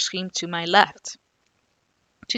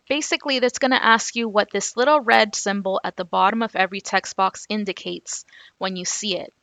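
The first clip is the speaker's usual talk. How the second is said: normal talking voice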